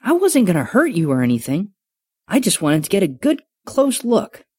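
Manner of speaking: put-on a boy's voice with a defensive edge; slightly defensive. Bobby insists to the dragon he meant no harm, defensive. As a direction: explain yourself, a bit defensive. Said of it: "slightly defensive edge".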